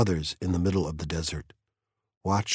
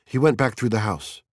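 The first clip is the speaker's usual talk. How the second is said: usual speaking voice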